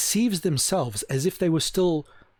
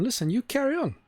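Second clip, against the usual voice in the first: mock breezy encouragement; 9 semitones higher than usual. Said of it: "Someone who says to a woman".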